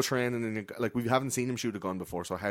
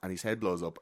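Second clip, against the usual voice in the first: ordinary talk, nothing marked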